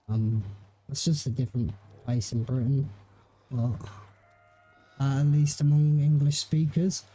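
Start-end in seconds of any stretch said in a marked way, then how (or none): none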